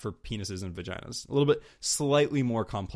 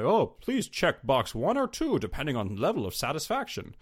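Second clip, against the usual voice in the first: robotic survey-taker; 5 semitones higher than usual. Acted. Podcaster mocking a mid-sex survey, stilted robot voice. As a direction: recite it like an automated questionnaire. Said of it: "using that same kind of robot".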